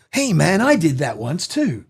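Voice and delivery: lightly voiced old surfer dude; a hint of surfer in the voice. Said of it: "looks like an old surfer".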